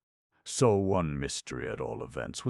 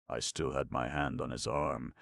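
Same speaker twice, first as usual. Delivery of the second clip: ordinary delivery, nothing marked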